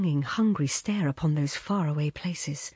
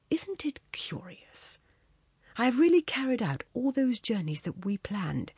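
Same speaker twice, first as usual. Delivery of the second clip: whispering; 5 semitones higher, 3 dB quieter than usual. Acted. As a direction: hush it, half amused. Strange half laugh, confiding.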